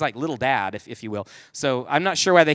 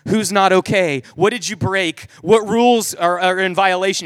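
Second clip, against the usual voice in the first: mock-panicked mom, getting louder; hammed-up motherly alarm; freaking out; 5 dB louder than usual. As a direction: fire off alarmed questions, building in volume. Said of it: "she is all freaking out".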